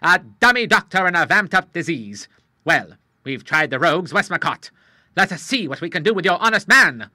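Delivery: put-on an old salt spoiling for a challenge; lightly voiced hearty old Admiral; old sea captain, eager. Admiral indignant.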